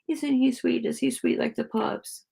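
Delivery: high-pitched cooing to a dog; pet talk. Real, not acted. Gushing over the sweet dog, high pet talk.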